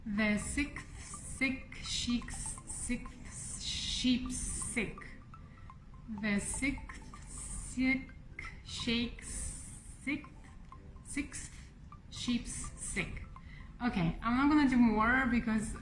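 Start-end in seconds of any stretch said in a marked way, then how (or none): 0.1-5.0 slow whispered tongue twister. Shown as a demonstration. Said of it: "Let me try a couple of times". 6.2-13.2 slow whispered tongue twister. Shown as a demonstration. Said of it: "Let me try a couple of times".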